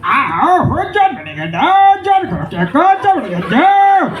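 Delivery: high, slow, stretched cartoon villain voice; cartoon don voice. Comic telling kids a cartoon don's lines, high and stretched. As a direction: ham up the cartoon don, squeaky and drawn out. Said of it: "Motu Patlu का John डॉन कह रहा था".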